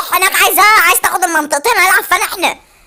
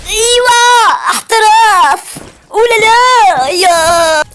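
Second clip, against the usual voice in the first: higher, louder hype call; excited celebration; 6 semitones higher, 4 dB louder, much slower than usual. Real, not acted. Gamer gloating at rival, high loud excited hype. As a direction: crow over the win.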